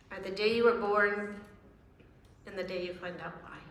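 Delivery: ordinary delivery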